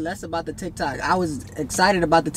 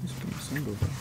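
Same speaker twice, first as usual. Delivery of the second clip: mumbled much softer, half to himself; a sulky aside muttered low; muttered under breath; 8 semitones lower, 15 dB quieter than usual. Real, not acted. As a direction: slip it out under your breath, not meant to be heard. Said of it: "Keep saying it under your breath then".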